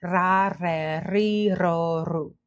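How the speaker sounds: "ra, re, ri, ro, ru" with trilled R; "ra, re, ri, ro, ru" as a rhythmic trill drill; rolled R drill. Shown as a demonstration. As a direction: roll every R through the vowel series. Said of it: "Take one minute to say".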